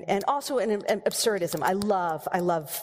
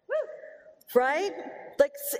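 ordinary delivery

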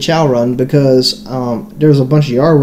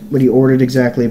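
ordinary talk, nothing marked

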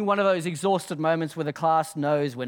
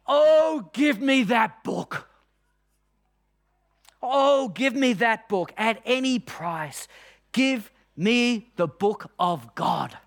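emphatic raised voice; 3 semitones higher, slower than usual. Shown as a demonstration. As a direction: proclaim each word slowly, with great emphasis. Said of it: "I'll say it with emphasis".

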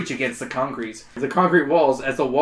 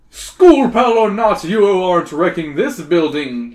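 crying out; 3 semitones higher, 7 dB louder than usual. Acted. Hero calling out to Wally the monster. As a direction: stand firm and call it out. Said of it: "you cry".

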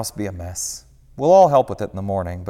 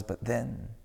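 ordinary delivery

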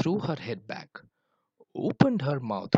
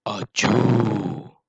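long, loud "achoo"; booming mock sneeze; 8 dB louder than usual. A narrator's reading. Wild Buffalo shows off to the animals, long loud sneeze. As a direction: boom out a drawn-out pretend sneeze. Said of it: "Wild Buffalo joined the group".

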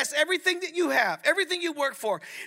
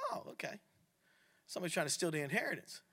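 quiet aside; 7 semitones lower, 12 dB quieter, faster than usual. Retold or imitated. Finding name tags on furniture.

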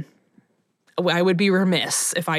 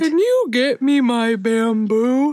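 whiny lazy panda; 4 semitones higher, slower than usual. Pretend. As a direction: whine for bamboo like a lazy panda. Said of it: "Calm down, daytime Emmy".

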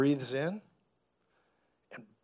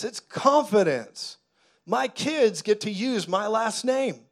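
usual speaking voice

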